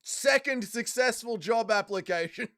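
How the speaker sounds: drawn-out triumphant proclamation; grand announcement. Acted. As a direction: proclaim the result to the crowd. Announcing another successful job application.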